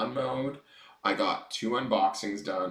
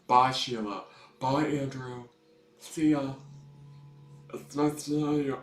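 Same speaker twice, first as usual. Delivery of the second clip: throaty drag queen voice; 3 semitones higher than usual. Acted. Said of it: "I love to talk in a throaty drag queen voice".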